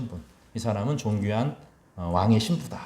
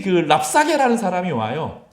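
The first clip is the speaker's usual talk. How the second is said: ordinary talk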